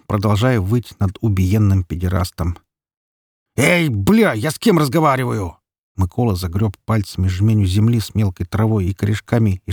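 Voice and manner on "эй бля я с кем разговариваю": high-pitched, irritated call; angry demand. A narrator's reading. Mikola yelling at his unresponsive son, angry. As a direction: demand to be listened to. Father angrily demands son's attention.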